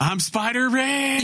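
high, drawn-out parody catchphrase; Pickle Rick. Retold or imitated. Spoofing a cartoon for the co-hosts, hammed-up triumphant cry. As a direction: belt out a silly high character boast, stretching the last word. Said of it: "go full Pickle Rick".

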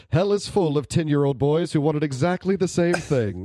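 slow, lightly voiced devil, laughing; robot devil voice. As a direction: drag it out like a smug devil laughing. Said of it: "the robot devil says".

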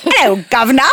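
cartoon Cockney greeting; jokey London street accent; mock British accent. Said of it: "you doing a different accent".

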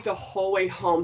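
ordinary talk

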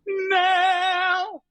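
high ringing mock shout of Male; retold cast shout. Backstage cast calling out the song, high mock shout. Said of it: "there was, like, a, a huge, like".

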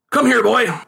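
lightly voiced father calling; calling to son. A narrator's reading. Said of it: "he called to his son".

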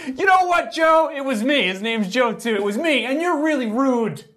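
hammed-up furious girlfriend; mock livid girlfriend. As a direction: imitate her snapping at him, half laughing. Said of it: "And then finally".

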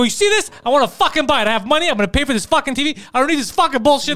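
high, pushed, rapid-fire tirade; retold angry rant. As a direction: replay going from zero to a hundred. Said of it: "I went from zero to, to a hundred".

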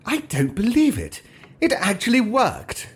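high, breathless disbelief; thrilled that the plan worked; a time traveller marvelling at his own success; amazed excitement. Acted. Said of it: "spur this apparition onwards as he continued".

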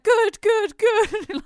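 high, loud, excited repetition; mock delighted relief. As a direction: imitate an overjoyed partner. Retold partner's delighted reaction.

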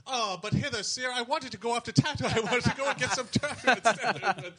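loud, very high-pitched pleading; young Luke parody. Acted. Parody of Luke answering back.

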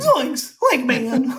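high-pitched cartoon slacker voice, laughing; Shaggy. Shown as a demonstration. Actor showing hosts the voice on request, Shaggy. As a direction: drop into the goofy cartoon voice, way up high. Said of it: "easy for you to do the voice".

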